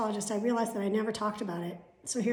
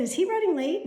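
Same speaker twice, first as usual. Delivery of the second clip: higher, louder quoted question; raised quote; 9 semitones higher, 6 dB louder than usual. Retold or imitated. Asking the office if the doctor is late, higher and louder. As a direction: pitch up the worried question. Retold phone quarrel with doctor.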